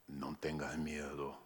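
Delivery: very low, very soft, flat droning angel; spooky angel voice. Pretend. Angel comforting María, eerie deep drone. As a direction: make the comfort sound frightening. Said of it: "con una voz así, lo que uno tiene es miedo".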